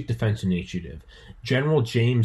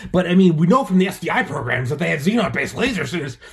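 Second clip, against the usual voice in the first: higher, louder know-it-all rant; nerdy rant; 7 semitones higher, 6 dB louder than usual. Retold or imitated. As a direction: launch into a nerdy fact dump. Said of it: "the next morning, I'm like".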